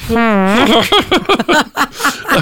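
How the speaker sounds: high burst of laughter. Real, not acted. Laughing at the joke.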